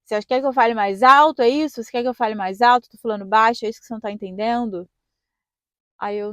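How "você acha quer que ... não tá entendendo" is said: loud, mocking examiner taunt; sarcastic examiner. Examiner mocking the student driver, loud and sarcastic. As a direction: throw the question back at her with contempt. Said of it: "o cara escrotérrimo".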